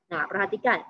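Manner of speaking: ordinary talk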